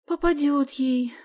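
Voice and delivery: high, slow worried sigh. A narrator's reading. Sonya worries for her runaway friend, sighing. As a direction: draw it out with a gentle sigh. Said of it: "вздохнула Соня".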